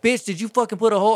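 high, loud accusing outburst; irate customer. Retold or imitated. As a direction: mimic an angry customer demanding an answer. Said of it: "was not fucking happy about it".

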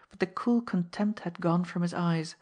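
ordinary delivery, nothing marked

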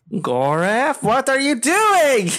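surprised call. Acted. Character reacts to door kicked.

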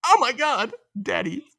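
high-pitched pretend swoon, dropping off at the end; mock swoon. Podcaster mocks Rachel's reaction to praise, high mock swoon. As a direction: gush in mock rapture.

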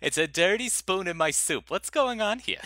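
high, loud indignant diner; fussy complaining customer. Pretend.

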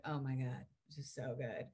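low, soft retold awe; quietly impressed. Retold reaction to teacher's words.

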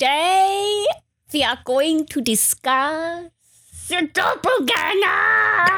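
high, slow, booming reveal of the topic; dramatic announcer. Acted. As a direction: announce it like a horror trailer.

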